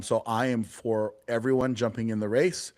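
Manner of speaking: ordinary talk, nothing marked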